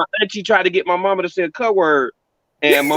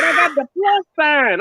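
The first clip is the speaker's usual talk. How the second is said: imitating mama; 9 semitones higher than usual. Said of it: "She said".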